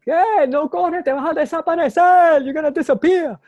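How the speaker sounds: very high, loud mock heckling; shrieky imitation of street hecklers; imitated street screaming. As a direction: holler it like neighbors screaming down the street. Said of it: "people was screaming the street".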